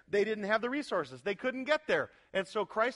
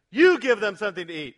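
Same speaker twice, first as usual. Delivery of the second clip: stern command; 9 dB louder than usual. Retold or imitated. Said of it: "he says".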